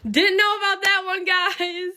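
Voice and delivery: high, drawn-out wail to the audience; mock-dismayed singsong exclamation. Real, not acted. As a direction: sing it out high, stretching the last word.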